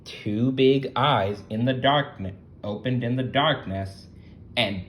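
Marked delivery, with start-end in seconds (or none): none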